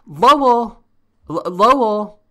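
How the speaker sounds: loud, high, drawn-out holler; calling out. Acted. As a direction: summon someone from the back room. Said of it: "need to call somebody in".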